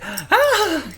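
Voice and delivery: sudden high yelp of fright; startled scream. Retold or imitated. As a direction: shriek like someone jumped you. Startled by tap on shoulder.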